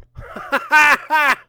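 loud hearty Santa laugh. Acted. Santa laughing at his own joke, loud and hearty.

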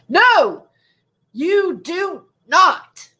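slow, emphatic mock telling-off; aggressive retelling. Reading out her all-caps reply to show the aggression, slow and forceful. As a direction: imitate her aggression, each word drawn out and separate. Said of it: "it's got this aggression to it".

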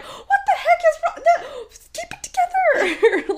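high, loud laughing self-scolding; laughing panic. Retold or imitated. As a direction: laugh while yelling at yourself, pitched high. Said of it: "I was like".

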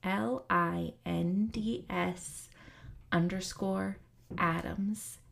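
soft, measured read of a disclaimer and plugs; podcast intro read. Acted. Host reading the disclaimer and her Instagram handle, calm and measured.

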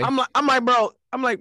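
high, loud exclaiming; excited exclaim. Real, not acted. Exclaims over friend's claim.

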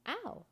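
short high yelp of pain. A narrator's reading. Boy poked in the eye, high yelp.

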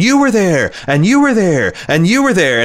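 high, exclaiming Dorothy at the end of the Wizard of Oz; a mock-wondrous Dorothy, pointing at everyone; Dorothy waking up. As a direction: pitch it up and marvel at each face, like Dorothy. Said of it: "where Dorothy wakes up and".

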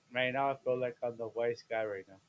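normal talking voice

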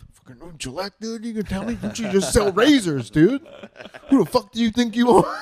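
high, loud indignant rant; hammed-up furious Twitter rant, high and loud; mock outrage. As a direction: rant at a razor brand in mock fury. Retold angry Twitter rant.

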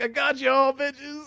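high-pitched prankster's crow; mock gloating. Friend acts out video's punchline on the family, mock gloating. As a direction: crow it high, imitating a triumphant trickster. Retold prankster springs the trick.

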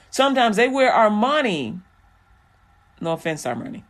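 ordinary talk

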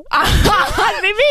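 high, slow laughing mock. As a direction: laugh it out in a squeak. Mocking Dave's answer, laughing.

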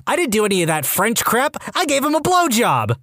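mock yell of an outraged defendant; indignant yell. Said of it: "stands up and straight up yells".

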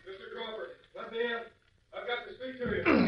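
calling from behind a closed door, muffled and far; distant muffled call at the door; muffled through door. Acted. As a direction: shout through the door from outside.

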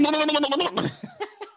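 gibber manically after a goading yell; mock-crazed wordless babble; frenzied babbling.

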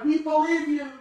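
high, thin cheer of a crowd; be the whole crowd shouting back. Retold or imitated. Crowd cheering back at the tightrope walker, high. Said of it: "they cried".

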